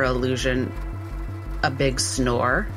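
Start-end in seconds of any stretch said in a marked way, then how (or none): none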